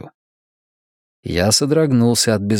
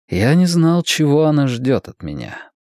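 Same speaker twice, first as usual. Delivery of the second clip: ordinary delivery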